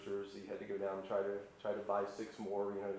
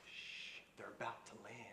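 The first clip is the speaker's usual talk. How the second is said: whispered hush, much softer; hushed rooster; 12 dB quieter than usual. Retold or imitated. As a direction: whisper so the buzzards don't hear. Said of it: "Brewster looks up and says".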